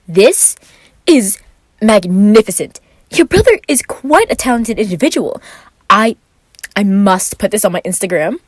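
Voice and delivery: high, loud gushing delight; delighted Roman. Acted.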